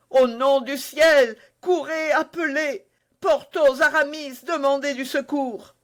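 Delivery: high, loud frantic cries for help; frantic plea for help; desperate shout. Acted. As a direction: shout orders at friends in panic.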